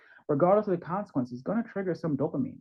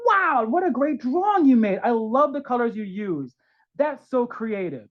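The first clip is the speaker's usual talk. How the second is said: high, loud, slow gushing over a drawing; enthusiastic praise; 8 semitones higher, 6 dB louder than usual. Pretend. Parent praising a child's drawing, loud and excited. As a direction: hype up the kid's art. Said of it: "be like".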